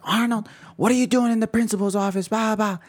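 high-pitched imitation of a cross mother; scolding mom. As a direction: mimic mom demanding answers on the phone. Mom scolding son on the phone.

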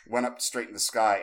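normal talking voice

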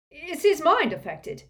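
high, loud alarmed question; terrified. A narrator's reading. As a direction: ask in fright about your brother. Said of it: "looking terrified".

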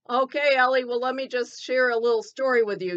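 ordinary delivery

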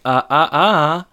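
mild comic dithering; stammering manager. Retold or imitated. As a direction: stumble like a nervous boss.

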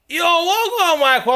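loud, high hail across a carriage; calling out greeting. Retold or imitated. Stranger hailing a masked friend on the train, calling out.

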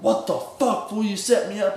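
hammed-up outrage at a friend; mock angry. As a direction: demand an answer over the phone. Retold angry phone accusation.

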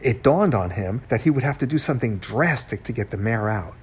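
usual speaking voice

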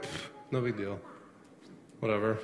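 low, soft, indifferent brush-off; dismissive shrug. Acted. Preacher acting a spouse who doesn't care about cheating, dismissive shrug. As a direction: shrug it off under your breath. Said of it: "you just kinda shrugged your shoulders".

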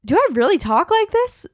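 high, disbelieving self-question; incredulous. Retold or imitated. Hearing her own recording, shocked and incredulous. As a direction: hear yourself and recoil. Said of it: "I'm just thinking".

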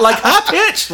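very high, loud squeal; high-pitched. Retold or imitated. Man mimicking his startled scream, high-pitched squeal. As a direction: imitate your own girlish scream. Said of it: "screaming like a girl".

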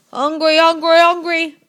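put-on loud, high baby demand; hollering baby. A narrator's reading. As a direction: holler for food like a huge baby. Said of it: "The baby began to holler too".

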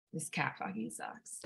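low, very soft quote; hushed, dropped-voice imitation of a complaint; retold muttered verdict. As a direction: mutter it under your breath. Mother's retold low admission.

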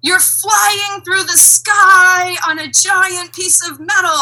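high, loud, drawn-out exasperated rant; imitated comedian's yell. Retelling a comedian's airplane skit, mock yelling. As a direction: belt it out slowly like a comic on stage. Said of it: "he yells into the microphone".

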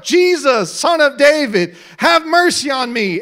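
loud cry for mercy; shouting. Acted. Blind man calling to Jesus, shouted. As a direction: shout the plea out. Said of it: "began to shout".

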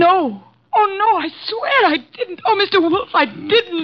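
high, breaking, close to sobbing; tearful denial. Acted. Secretary swearing to Mister Wolf she's innocent, tearful. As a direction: plead through rising tears. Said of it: "for heaven's sake, no tears".

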